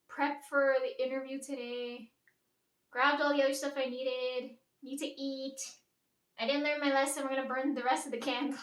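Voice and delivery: ordinary delivery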